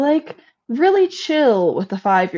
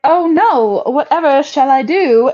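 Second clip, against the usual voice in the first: mock damsel; 6 semitones higher, 4 dB louder than usual. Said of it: "And I was like".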